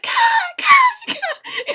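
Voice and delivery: very high, loud, squealing fan voice; imitating excited fans. Fans at the fence squealing over Kai in a high voice. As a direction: squeal the name like a thrilled fan. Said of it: "And they'd be like".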